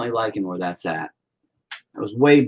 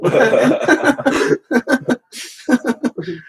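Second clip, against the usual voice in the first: high loud burst of laughter; 11 semitones higher, 4 dB louder than usual. Real, not acted. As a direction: crack up laughing. Teased about Jumbo Peep.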